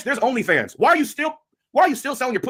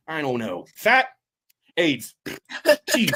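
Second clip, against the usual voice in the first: ordinary talk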